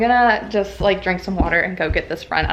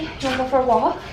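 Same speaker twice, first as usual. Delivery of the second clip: talking to a dog; 4 semitones higher, faster than usual. Real, not acted. Asking dog about walk.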